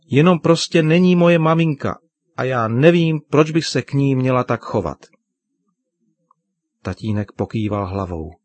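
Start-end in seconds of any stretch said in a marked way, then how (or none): 0.0-5.0 higher, louder defiant protest; a young girl objecting, pitched up. A narrator's reading. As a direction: insist stubbornly, voice raised. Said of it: "protestovala Pavla".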